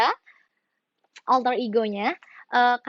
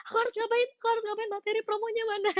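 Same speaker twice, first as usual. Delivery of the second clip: high, softer flustered self-talk; panicked; 11 semitones higher, 4 dB quieter, much faster than usual. Retold or imitated. Singer asking herself about missing promo material, mock panicked. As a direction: re-enact helpless panic, pitched way up. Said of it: "aku kayak panik gitu".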